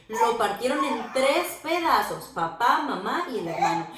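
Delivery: usual speaking voice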